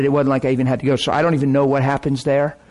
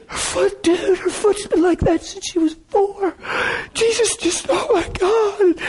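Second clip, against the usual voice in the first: very high, crying squeak; tearful. Retold or imitated. Friend tells his buddy about her foot, crying. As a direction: choke it out high through mock sobs. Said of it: "he's just crying".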